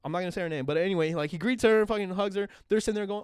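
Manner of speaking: ordinary talk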